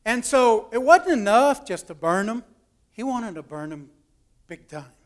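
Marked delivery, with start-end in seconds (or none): none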